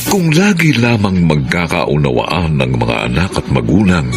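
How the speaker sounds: ordinary talk, nothing marked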